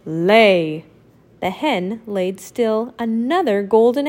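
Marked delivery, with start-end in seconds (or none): 0.1-0.9 dim giant cyclops. Acted. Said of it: "the giant said".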